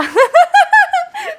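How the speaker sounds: high loud laugh; burst of laughter. Real, not acted. Streamer laughing at her own exaggeration, loud. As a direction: laugh out at yourself.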